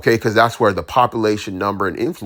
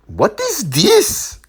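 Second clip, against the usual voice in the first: mock amazement; much slower than usual. Mock amazed at her beauty.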